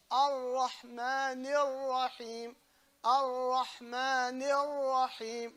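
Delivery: very slow "Ar-Rahman Ar-Rahim" with long trilled r; chant-like "Ar-Rahman Ar-Rahim", doubled ras sustained; held ra. Shown as a demonstration. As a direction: stretch each r in "Ar-Rahman Ar-Rahim". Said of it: "You hold the ra".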